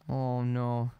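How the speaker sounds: ordinary talk, nothing marked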